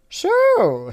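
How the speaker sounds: cartoonish villain squeak; high Dumbledore. Dumbledore threatening the Teletubbies, very high. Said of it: "said Dumbledore".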